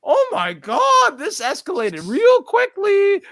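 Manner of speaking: very high, slow mock amazement; drawn-out falsetto disbelief; stunned reaction. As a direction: squeal it slowly, as if watching things blow up. Retelling own stunned reaction to the fight.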